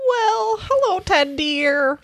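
put-on high, sweet old lady voice; Grandma voice. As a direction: sing it out like a doting grandma. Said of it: "Okay, I'll be Grandma".

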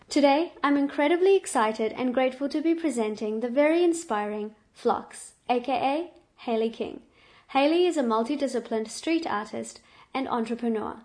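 loud, upbeat guest introduction; excited announcer. Acted. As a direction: present her like a headline act. Host introduces guest, louder.

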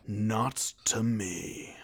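low, very slow, solemn hero; Nicolas Cage. Acted. Film hero refusing to bargain over a treasure, mock-solemn. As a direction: draw it out slowly in a low voice. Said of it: "when Nicolas Cage is accused of".

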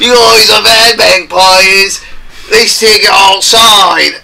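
loud, slow hammy English tough; drawn-out mock English brawler; deep English accent. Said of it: "talk like a deep English accent".

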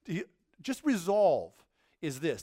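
ordinary delivery

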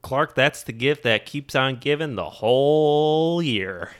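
slow, dopey cousin Eddie; slow lightly voiced Eddie; dim cousin voice. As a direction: drag it out like Eddie. Imitating Eddie slowly.

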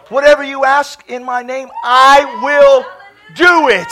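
loud, deliberate proclamation; emphatic scripture preaching. Real, not acted. Preacher proclaiming God's promise on prayer, loud and emphatic. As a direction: thunder out each phrase. Quotes scripture at full force.

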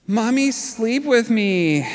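put-on high, slow, drawn-out toddler plea; little child pleading. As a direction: beg slowly like a tired, scared little boy. Son pleading from dark room.

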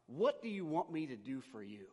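ordinary talk

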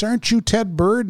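a hint of an eager fan; lady's voice. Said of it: "the lady behind me goes".

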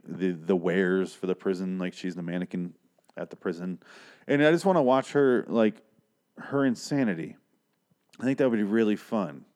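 usual speaking voice

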